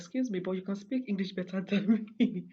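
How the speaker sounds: loud, high retort with a laugh breaking in; laughing disbelief. Retold or imitated. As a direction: tease her with a laugh. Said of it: "I was".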